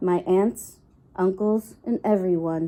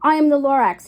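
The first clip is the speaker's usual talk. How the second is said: usual speaking voice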